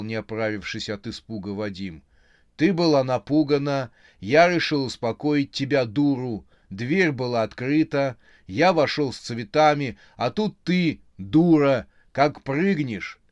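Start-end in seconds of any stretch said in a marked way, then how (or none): 2.6-13.2 loud, higher angry rant; angry quarrel. A narrator's reading. Vadim berating Yulia in the quarrel, loud. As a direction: berate her angrily.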